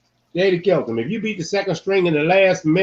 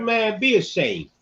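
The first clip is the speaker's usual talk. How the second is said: mock scolding; 7 semitones higher, 4 dB quieter, slower than usual. Teasing rival team's fans.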